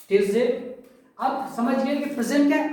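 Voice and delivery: ordinary delivery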